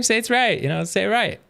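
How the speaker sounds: ordinary delivery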